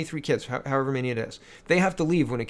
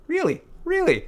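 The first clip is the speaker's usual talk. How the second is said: high-pitched, disbelieving question; incredulous; 12 semitones higher than usual. Real, not acted. Reacting to a tone-deaf headline, incredulous. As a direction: jump up high in sheer disbelief.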